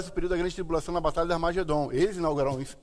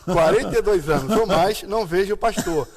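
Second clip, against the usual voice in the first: lendo entre risos, mais alto; relê o trecho rindo; 4 semitones higher, 7 dB louder than usual. Acted. (lendo rindo)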